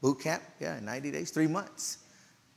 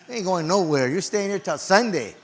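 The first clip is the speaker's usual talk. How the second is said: mock-stern refusal, louder and higher; lightly voiced bossy uncle; 4 semitones higher, 7 dB louder than usual. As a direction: lay down the law as the uncle. Retold uncle refusing to let him go.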